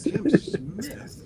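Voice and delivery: high-pitched puzzled query; feigned disbelief, pitched high; mock incredulous. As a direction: act baffled by the name, voice way up. Retold puzzled reply about a name.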